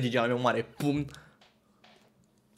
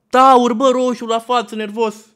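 loud, high storytelling outburst; hyped shouted exaggeration; worked-up shout; 9 semitones higher, 11 dB louder than usual. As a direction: bellow the description for comic impact. Describes the enraged landlord, shouting it.

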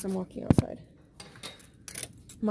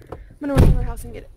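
fast and loud, eager; excited; 3 semitones higher, 12 dB louder, much faster than usual. Real, not acted. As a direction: blurt it out eagerly. Excited to get casserole.

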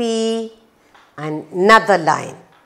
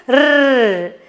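high, long "rrr" like a tugging puppy; drawn-out growled R sound; playful puppy growl; 4 semitones higher than usual. Pretend. Teacher as puppy pulling the rag, high playful "rrr". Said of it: "puppy pulls the rag".

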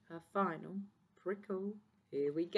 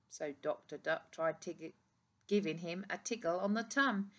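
ordinary delivery

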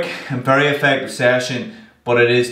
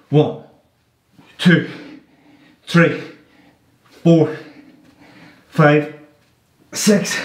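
doing push ups; 4 semitones higher, much slower than usual. Real, not acted. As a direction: count the reps while pushing hard. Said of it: "chest is burning".

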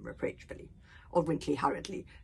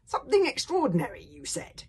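put-on loud, higher-pitched aggrieved boy; indignant young grumble; complaining; 9 semitones higher, 8 dB louder than usual. A narrator's reading. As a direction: complain loudly to grandfather. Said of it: "complained Hiccup".

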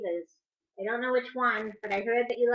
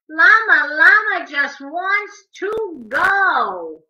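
lively, louder sing-song read-aloud; animated picture-book reading; reading to children; 5 semitones higher, 9 dB louder, slower than usual. A narrator's reading. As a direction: read the rhymes to little kids, bouncing on the beat.

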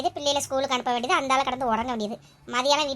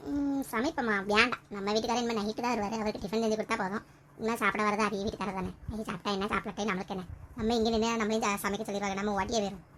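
low, soft private grumble; talking to oneself; 5 semitones lower, 6 dB quieter than usual. Acted. Deciding alone to slip away from cooking, talking to oneself quietly. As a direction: keep it under your voice, lower and quieter. Talks to self, avoiding cooking.

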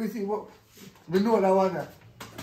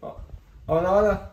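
ordinary talk, nothing marked

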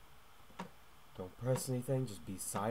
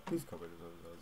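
hushed warning; 7 semitones lower, 8 dB quieter, faster than usual. Real, not acted. Homeowner approaching, hushed.

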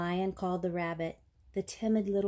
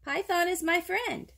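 high protesting iguana voice; iguana's cry; 10 semitones higher, 3 dB louder than usual. A narrator's reading. Accused iguana defends himself, high protesting cry. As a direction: insist on innocence, pitched right up. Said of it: "cried the iguana".

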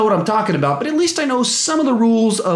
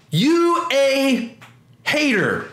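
high, sassy put-on voice; put-on dismissive voice; 8 semitones higher, much slower than usual. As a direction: toss it off like a taunt. Reading a comment in a put-on voice.

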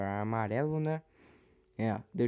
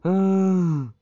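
startled yell; 8 semitones higher, 12 dB louder than usual. Real, not acted. Startled in game.

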